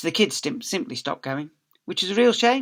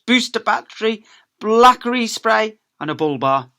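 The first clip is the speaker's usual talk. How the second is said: excited list; 7 semitones higher, 8 dB louder than usual. A narrator's reading. Narrator gleefully listing the scooter's upgrades, loud and eager. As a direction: sell each gadget with gusto.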